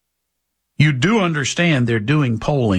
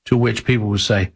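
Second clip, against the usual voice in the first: ordinary talk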